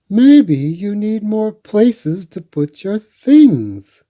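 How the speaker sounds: mother's voice. A narrator's reading. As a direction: kindly suggest it like a mother. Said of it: "said Franklin's mother".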